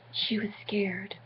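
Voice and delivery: slow hushed whisper; mock-ominous whispered line; dramatic whisper. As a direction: breathe it like a spooky secret. Dramatic reading of fear.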